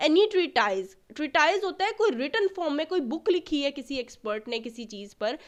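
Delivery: ordinary talk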